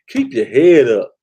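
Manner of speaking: loud encouragement. Real, not acted. Host rallying his viewers, a slow loud encouraging call. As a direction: call it out to lift them up.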